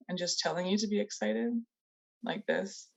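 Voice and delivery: dull, unenthusiastic trainer voice; flat, bored delivery. Shown as a demonstration.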